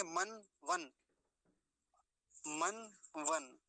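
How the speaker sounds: मन वन slowly, each closed on a held n; separate, drawn-out "man, van" for मन वन; half न. Shown as a demonstration. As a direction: close मन and वन on न् with no vowel. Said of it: "कैसे बोलेंगे?".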